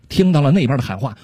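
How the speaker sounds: normal talking voice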